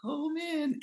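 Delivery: hammed-up motherly worry, way up high; mock worried mom. Mock mom worrying before his run, high pitched. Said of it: "it's not even like a mom concerned".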